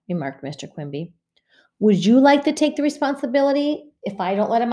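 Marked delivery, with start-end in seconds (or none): 1.8-4.7 exasperated mother. Acted. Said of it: "asked Missus Quimby".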